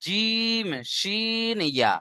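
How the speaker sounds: জিম, শিন held high and long, then short ইয়া; mid-tongue letter demo. Shown as a demonstration. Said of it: "জিভের মাঝখান থেকে এসে উচ্চারণ হয়".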